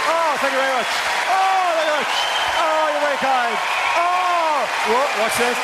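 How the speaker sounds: very high, drawn-out thanks; gushing astonishment. Acted. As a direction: gush as if overwhelmed by applause. Comic milks the audience's applause.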